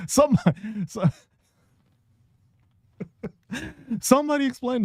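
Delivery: high, fast, laughing; breathless laughing plea. Real, not acted. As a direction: squeeze it out through giggles. Laughing through rant.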